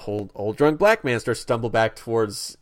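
ordinary talk

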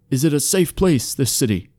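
lightly voiced, hesitant elf question; wary elf. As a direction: ask cautiously, a hint of formal elf. Wary elf asks about the city.

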